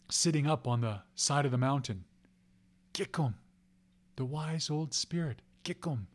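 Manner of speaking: ordinary delivery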